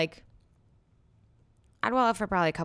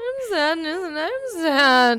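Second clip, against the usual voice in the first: high, loud, slow mock wail; wailing sad voice; 8 semitones higher, 8 dB louder, much slower than usual. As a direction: wail it melodramatically.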